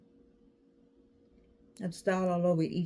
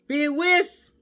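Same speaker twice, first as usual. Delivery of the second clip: excited; 8 semitones higher, 7 dB louder than usual. Real, not acted. Card fan naming her favorite game, excited shout. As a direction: Bid Whist shouted high and loud. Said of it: "I got excited".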